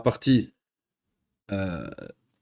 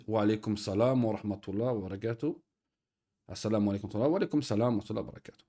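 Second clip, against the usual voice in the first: quick mumbled greeting; 4 semitones lower, 6 dB quieter, faster than usual. Retold or imitated. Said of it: "Et puis ils disent".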